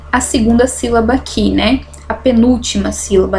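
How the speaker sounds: normal talking voice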